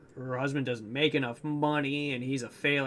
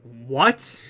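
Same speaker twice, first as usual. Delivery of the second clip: loud, incredulous outburst; stunned, louder reaction; shocked disbelief; 8 dB louder than usual. Retold or imitated. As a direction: blurt it out in disbelief. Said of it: "I'm like".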